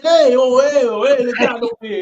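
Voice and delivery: lightly hammed Italian accent; a hint of Italian, high and loud. Mocking his friend in a light Italian accent. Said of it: "talking over here like an Italian".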